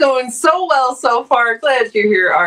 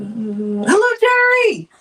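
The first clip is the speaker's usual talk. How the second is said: called-out greeting; 3 semitones higher, slower than usual. Real, not acted. Streamer calls out greeting to viewer.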